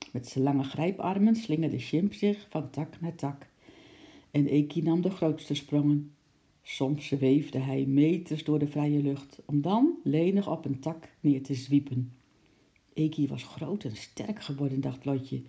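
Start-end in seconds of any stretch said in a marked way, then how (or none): none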